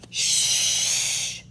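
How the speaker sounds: loud, drawn-out hush; long shush. Acted. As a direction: hush the whole crowd. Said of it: "said Badger".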